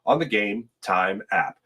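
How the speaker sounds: high, punchy announcer pitch for the app; hyped ad read. Acted. Host to stream viewers, pumped-up sponsor ad read. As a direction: sell it like a radio spot.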